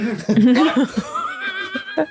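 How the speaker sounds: comic laughing at his own story; burst of laughter. Real, not acted. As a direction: cackle at the punchline.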